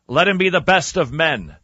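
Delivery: ordinary talk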